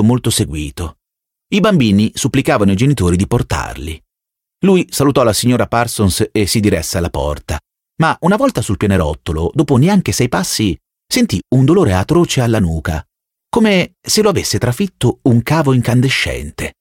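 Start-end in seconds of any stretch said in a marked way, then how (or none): none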